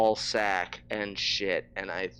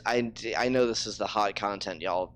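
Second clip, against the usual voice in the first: normal talking voice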